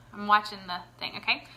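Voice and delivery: ordinary talk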